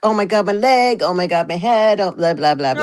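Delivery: mock yourself moaning in pain; hammed-up aching complaints, half laughing; mocking groans. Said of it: "you're gonna hear".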